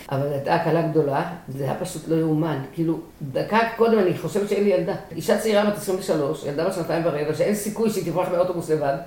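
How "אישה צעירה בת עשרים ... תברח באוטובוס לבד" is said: usual speaking voice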